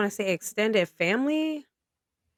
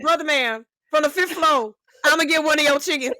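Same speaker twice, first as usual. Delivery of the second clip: swaggering neighbor; 7 semitones higher than usual. Pretend. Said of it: "how would I have to say it".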